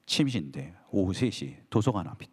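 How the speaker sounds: 아주 낮고 엄숙한 하나님 음성; 멀리서 울리듯 낮게 선언; low divine voice. Retold or imitated. God's answer repeated, slow. (낮고 엄숙하게 선언하는 하나님 목소리)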